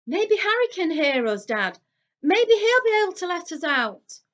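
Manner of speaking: high, loud eager voice; boy hoping to be heard; hopeful twin. A narrator's reading. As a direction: son talking to his dad in the chimney. Voice inside chimney suggesting.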